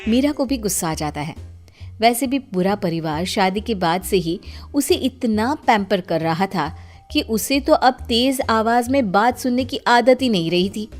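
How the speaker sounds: plain reading voice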